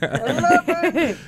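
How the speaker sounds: very high, stretched-out demand; comic escalation, half laughing; mock director at full tilt. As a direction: draw the word out high and hammy.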